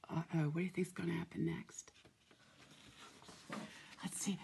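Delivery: quick excited whisper. Acted. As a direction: whisper it like a secret. Suspenseful aside to children.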